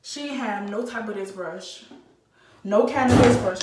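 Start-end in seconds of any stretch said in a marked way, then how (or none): 2.6-3.6 a loud, real blurt of disbelief; exasperated outburst. Real, not acted. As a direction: burst out at the missing brush. Ranting about the salon's brushes.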